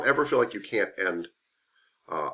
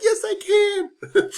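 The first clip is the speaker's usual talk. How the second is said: laughing; 5 dB louder, much slower than usual. Retold or imitated. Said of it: "And I'm like".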